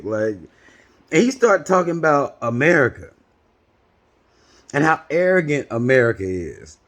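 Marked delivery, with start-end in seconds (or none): none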